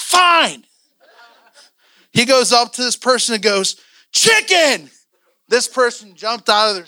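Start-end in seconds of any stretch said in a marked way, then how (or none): 0.0-0.7 high, loud mock-exasperated outburst; exasperated giving in. Acts out grudging, exasperated surrender. 4.1-4.9 "Chicken" shouted high and loud, falling; blurted shout. Retold or imitated. Acts out blurting it at the person.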